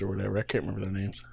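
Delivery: whispered aside; whispering. Real, not acted. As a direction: drop to a whisper. Trailing off quietly.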